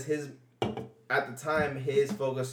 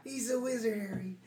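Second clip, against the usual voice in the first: high, put-on quoting voice; hammed-up movie quote; 10 semitones higher, 6 dB quieter than usual. Riffing on friend's wizard remark, hammed-up movie quote. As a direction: quote it playfully in a raised put-on voice.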